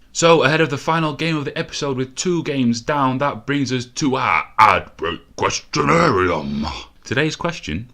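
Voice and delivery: hammed-up announcer read. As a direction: present the ad break like a game show host. Ad break announcer voice.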